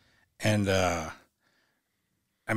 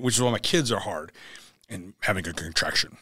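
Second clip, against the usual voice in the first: ordinary delivery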